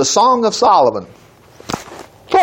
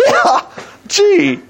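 laughing; 12 semitones higher than usual. Real, not acted. Still laughing.

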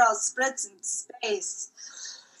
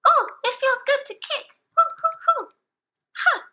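put-on high, childlike robot voice; squeaky little android waking up; robot child voice; 11 semitones higher than usual. Robot child greeting the crew in a high voice.

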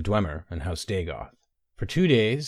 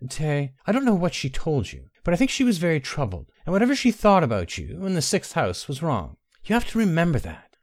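pleading; 7 semitones higher than usual. A narrator's reading. Benara pleading with her cousin.

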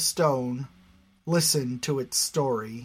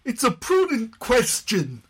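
higher, louder indignant retort; 10 semitones higher, 5 dB louder than usual. Acted. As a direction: defend the question indignantly. Crowd member defends question.

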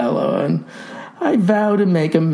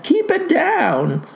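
high, slow pleading plea; pleading youth; 12 semitones higher, slower than usual. Acted. Boy begs the farmer to keep his voice down, pleading high. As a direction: beg him much higher and drawn out. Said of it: "pleaded the lad".

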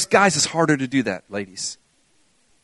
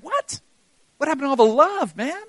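mock shock; 7 semitones higher than usual. Preacher reacts to harsh psalm.

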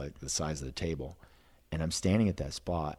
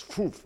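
whoosh sound; 12 semitones higher than usual. Retold or imitated. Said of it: "comes around the tree and goes".

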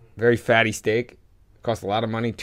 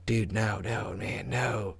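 imitating a friend's soft begging; retold hushed plea; 6 dB quieter, slower than usual. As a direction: quietly talk someone out of it, softer. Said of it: "he was just like".

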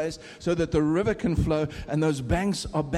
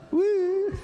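mock woo cheer. Urging the British crowd to cheer.

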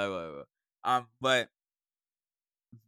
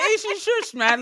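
mock shushing; 7 dB louder than usual. Teacher acting out shushing someone.

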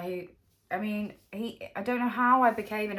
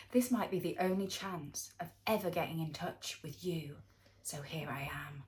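breathy creepy whisper reading a DM; whispery voice; 3 semitones lower, 6 dB quieter than usual. Acted. Reading a stranger's DM in a creepy whisper. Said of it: "Why do you have to read it in that whispery voice?".

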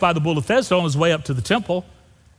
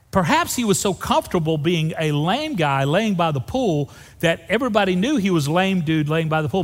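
ordinary talk

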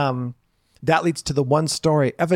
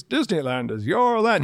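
Walt Disney's voice; 4 semitones higher than usual. Retold or imitated. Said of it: "you'd hear Walt Disney's voice".

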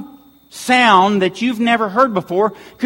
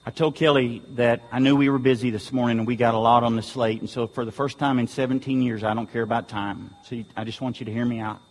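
quiet; 9 semitones lower, 8 dB quieter than usual. Real, not acted. Quiet personal plea to the church.

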